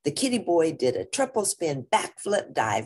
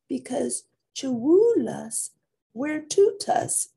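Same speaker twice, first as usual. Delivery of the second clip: sister voice; 10 semitones higher than usual. Acted. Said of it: "said Jezebel".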